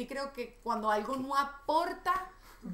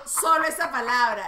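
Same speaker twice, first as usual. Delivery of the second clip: louder, hammed-up emphasis; demonstrated shouting; 10 dB louder than usual. Shown as a demonstration. Woman shows how autocorrected capitals read, mock shouting. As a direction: punch the words out loud as a mock shout. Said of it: "piensa que le estoy gritando".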